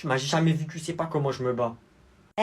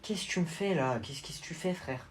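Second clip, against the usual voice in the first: puzzled murmur; 10 dB quieter than usual. Acted. Confused quiet reaction.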